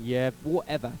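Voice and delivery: low, sceptical aside; unimpressed, disbelieving thought; dismissive. Retold or imitated. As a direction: brush it off in a low voice. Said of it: "And I'm thinking".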